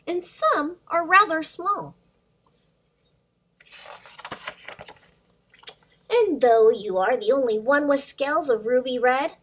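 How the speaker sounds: put-on lively storybook read-aloud for little kids; reading to children. Teacher reads a picture book to her students, lively and animated. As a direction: bring the picture book to life for the class.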